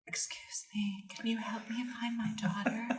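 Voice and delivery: desperate whispered plea; whispered movie plea. Acted. Guest quizzes hosts, in a whispered Meryl Streep plea. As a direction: breathe it out as a frantic whisper. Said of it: "What accent is this?".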